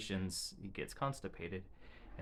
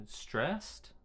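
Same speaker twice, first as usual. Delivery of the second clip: higher, gently guessing word; coaxing high question; 8 semitones higher than usual. Retold or imitated. Mother guessing her son's feeling, higher coaxing question. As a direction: offer the word to a child.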